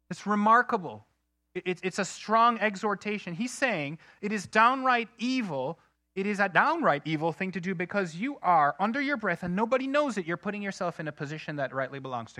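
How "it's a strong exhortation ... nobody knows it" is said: ordinary talk, nothing marked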